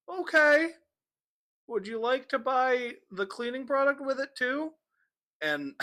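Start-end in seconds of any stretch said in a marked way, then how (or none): 0.1-4.7 high, bright salesy cashier voice; perky cashier. Retold or imitated. Cashier offering the cleaning product, high chirpy voice. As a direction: pitch it high and chirpy like an upsell. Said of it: "she goes".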